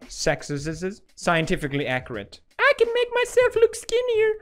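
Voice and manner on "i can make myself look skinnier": very high squeaky mock girl voice; girly voice. Mocking how girls talk, in a very high squeaky voice. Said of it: "This is how girls talk".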